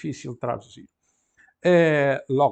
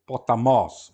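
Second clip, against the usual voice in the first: "Potamos" louder, stressed on the last syllable; acute final accent; 2 semitones lower, 7 dB louder, slower than usual. Shown as a demonstration. Teacher explaining acute accent, "Potamos" end-stressed. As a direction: punch the "mos" of "Potamos". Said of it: "o acento é agudo".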